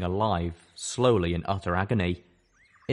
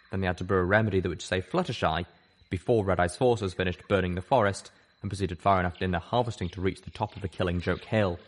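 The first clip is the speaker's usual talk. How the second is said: ordinary delivery, nothing marked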